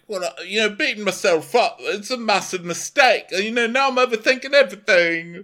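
high, loud mock self-pity; put-on Tom voice. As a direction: wail it as a hammed-up complainer. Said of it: "so Tom's like".

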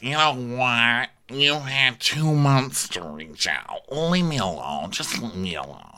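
slow, drawn-out mock brush-off; Meredith voice. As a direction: languid put-on voice, pushing someone away. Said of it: "Meredith is like".

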